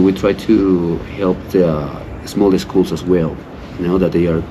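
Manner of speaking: ordinary talk, nothing marked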